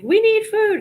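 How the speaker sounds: high, loud urgent plea; mock urgent cry for food; exclaiming. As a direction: announce it like an emergency. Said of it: "I was like".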